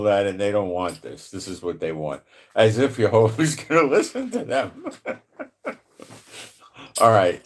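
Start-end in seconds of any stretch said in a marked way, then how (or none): 2.5-5.2 laughing. Real, not acted. Scoffing at the nations.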